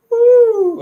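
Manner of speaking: a sudden high, loud woo; excited whoop. Real, not acted. Streamer to his viewers: an excited high loud whoop. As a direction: whoop with real excitement mid-sentence.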